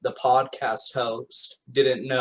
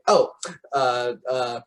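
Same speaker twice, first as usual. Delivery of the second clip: ordinary talk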